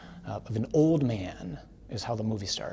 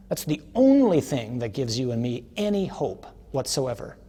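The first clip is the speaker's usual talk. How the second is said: loud, high, emphatic sermon climax; impassioned preaching; 5 semitones higher, 6 dB louder, slower than usual. Real, not acted. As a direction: proclaim it with full force, punching the key words. Preaches the climax at full force.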